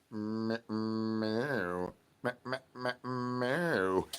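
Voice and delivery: quiet stuttered meowing; soft cat meows. Acted. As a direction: meow softly like a cat. Cat noises in routine.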